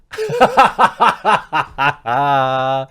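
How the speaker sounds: loud staccato burst of laughter; long belly laugh trailing off; big laugh. Real, not acted. Laughs at having been baited.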